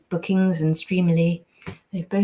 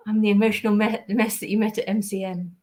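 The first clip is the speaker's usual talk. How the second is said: ordinary talk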